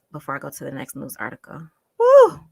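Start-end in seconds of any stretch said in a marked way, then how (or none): 2.0-2.4 loud high whoop of frustration; heated loud woo; exasperated yell. Real, not acted. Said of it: "I'm heated".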